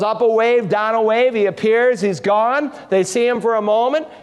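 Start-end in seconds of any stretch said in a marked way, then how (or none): none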